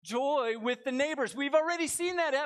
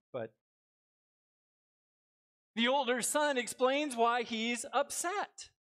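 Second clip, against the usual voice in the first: ordinary delivery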